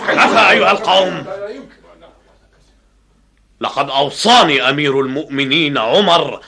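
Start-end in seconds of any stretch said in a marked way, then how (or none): 0.1-1.3 laughing exclamation. Acted. Commander exclaims to his men.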